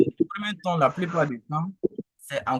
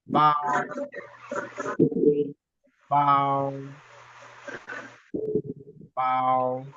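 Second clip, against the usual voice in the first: "Ba" held on one level pitch; even, drawn-out "Ba"; constant first tone; much slower than usual. Shown as a demonstration. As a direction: sustain "Ba" without movement. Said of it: "Le premier ton et le ton constant".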